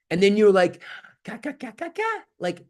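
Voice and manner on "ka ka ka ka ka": put-on high soft baby babbling; baby babble. A baby babbling after praise, high and soft.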